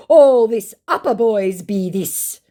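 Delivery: slow, high, emphatic impression; mock insistent scammer. Scammer defending the city boys, mock insistent. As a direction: lean on each word in a put-on voice. Acting scammer's pidgin reply.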